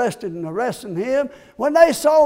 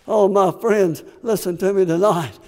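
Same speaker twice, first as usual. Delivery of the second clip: normal talking voice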